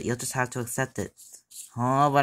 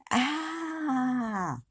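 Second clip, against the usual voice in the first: crying wail; 11 semitones higher, 5 dB quieter than usual. Acted. Tantrum kid wailing.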